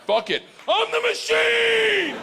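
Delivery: high, slow, shouted battle cry; triumphant yell. Retold or imitated. Drunk frat boy shouting his catchphrase at nine gangsters. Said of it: "Now I'm like".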